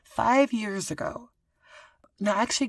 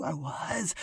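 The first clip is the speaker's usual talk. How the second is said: ordinary delivery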